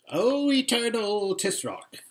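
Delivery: high, slow, fawning address; fawning vizier. Acted. Vizier to the Tisroc, high and fawning. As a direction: grovel up to the throne.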